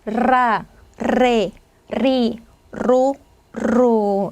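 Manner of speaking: 라, 레, 리, 로 each opening on a long trilled r; rolled r. Shown as a demonstration. Teacher drilling double r, 라, 레, 리, 로 strongly trilled. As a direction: vibrate the tongue into 라, 레, 리, 로. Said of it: "이렇게 떨어주시면 돼요".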